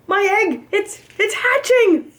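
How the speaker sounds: high, stammering wonder; excited. A narrator's reading. Horton watching the egg crack, high excited stammer. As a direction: gasp out the news in awe. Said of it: "said Horton".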